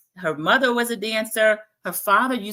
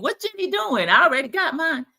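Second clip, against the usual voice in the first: much higher, then louder, hammed-up joking man; joking Tyson; 8 semitones higher, 3 dB louder than usual. Host voicing Tyson joking in confessional, hammed-up and high. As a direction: toss it off like a smug joke. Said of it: "confessional Tyson is joking".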